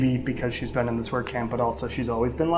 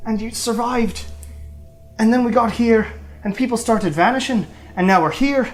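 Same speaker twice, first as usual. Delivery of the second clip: Nathaniel; 8 semitones higher than usual. Acted. Prisoner babbles to friends who survived, high excitable voice. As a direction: gush in a high, overexcited voice. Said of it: "And Nathaniel's like".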